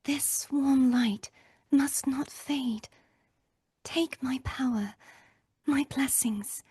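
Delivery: slow, breathy whispered vow; whispering. Acted. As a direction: whisper it softly, a sacred promise. Live line read, tender vow.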